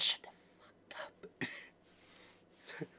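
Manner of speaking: tiny voiceless whisper; barely audible, sarcastic hush; excessively quiet. Acted. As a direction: whisper it with exaggerated softness. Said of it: "have it excessively quiet".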